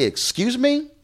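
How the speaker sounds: high-pitched, incredulous comeback; affronted disbelief. Retold or imitated. Reporter answering his editor's criticism of his production, affronted. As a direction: shoot up high as if you can't believe the insult.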